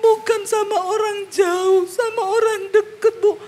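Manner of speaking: high, slow, tearful wail of a wounded woman; slow, high sobbing plea; mock crying woman. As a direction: whimper it out as a weeping churchgoer.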